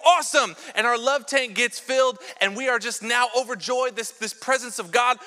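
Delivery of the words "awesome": a loud, higher mock-thrilled "Awesome"; excited cheer. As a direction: cheer it like a new believer. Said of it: "we go".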